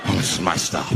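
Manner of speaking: very low, sheepish madman; back to his senses. Acted. Madman finished, leaving the bus, low and casual. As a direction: drop deep and casually excuse yourself. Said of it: "He came back to his senses".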